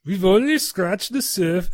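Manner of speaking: put-on first stab at a Russian accent. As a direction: attempt a jokey Russian scientist voice.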